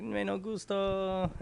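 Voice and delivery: slow, soft fake Spanish; mock Spanish accent. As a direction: drawl it in mock broken Spanish. Dying man's last words.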